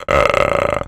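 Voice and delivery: low, loud creaky "uh"; slow the gasp into a low rattle; slowed inward growl. Shown as a demonstration. Showing how slowing the sound lowers it, low growl. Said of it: "slowing it down and making a".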